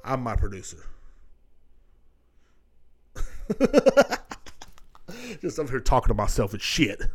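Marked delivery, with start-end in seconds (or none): 3.1-5.4 high burst of laughter at his own joke; laughing at himself. Real, not acted. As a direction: break into a high laugh at your own bit.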